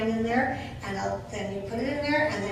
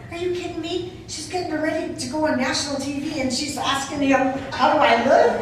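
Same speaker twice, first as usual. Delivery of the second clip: incredulous disbelief; 4 semitones higher, 6 dB louder than usual. Retold or imitated. Retelling her own disbelief.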